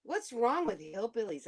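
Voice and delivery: usual speaking voice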